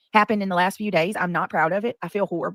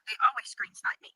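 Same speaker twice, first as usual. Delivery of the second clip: whispering; 4 semitones higher, 5 dB quieter than usual. Real, not acted. Muttered complaint.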